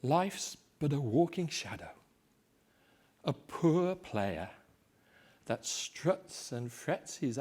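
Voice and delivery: slow, hushed, grieving soliloquy; Shakespeare recitation. Acted. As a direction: let each phrase fall into emptiness. Macbeth's tomorrow soliloquy recited.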